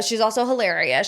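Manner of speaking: normal talking voice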